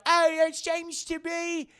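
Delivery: high, loud mock British woman; hammed-up British reporter, exclaiming; British accent. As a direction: put on a shrieky British TV-commentator voice. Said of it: "the British girl's like".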